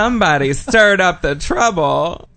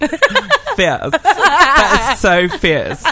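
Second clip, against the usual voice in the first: mock posh British accent, high and laughing; 8 semitones higher, 3 dB louder, much slower than usual. She hams up a British accent for the Londoners, laughing. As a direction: camp British drawl, laughing through it. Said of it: "Did you say that in a British accent?".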